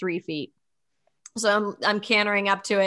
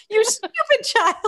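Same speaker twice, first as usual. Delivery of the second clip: much higher shrill scolding coach impression; Missus Henry; 4 dB louder than usual. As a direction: shriek it like an exasperated old coach. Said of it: "You just took the words out of my mouth".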